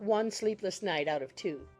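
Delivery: ordinary talk, nothing marked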